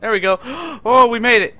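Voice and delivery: loud relieved cheer while fleeing; gasp, then shout with relief; being chased. Real, not acted. Escapes the chase.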